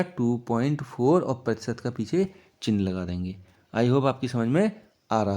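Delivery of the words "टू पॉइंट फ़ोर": टू पॉइंट फ़ोर spelled out slowly for the class; slow, stressed answer. Shown as a demonstration. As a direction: drag out टू पॉइंट फ़ोर word by word. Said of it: "कितना आएगा?".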